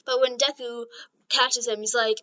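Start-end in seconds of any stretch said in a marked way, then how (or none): none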